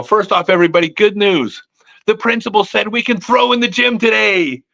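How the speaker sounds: put-on rally the kids with big good news; excited announcement.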